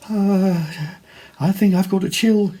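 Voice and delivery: high, loud, flustered man's voice; desperate Theodoric. A narrator's reading. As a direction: blurt it out, desperate and caught. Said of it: "he ventured desperately".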